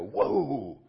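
loud, drawn-out whoa; re-enacted awestruck reaction; acted amazement. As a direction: let the wonder swell through the word. Acts reaction to reading the verse.

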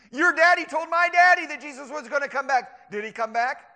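high, loud taunting challenge; scoffing. Acted. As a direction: hammer the believer with scorn. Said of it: "That's what the scoffers say".

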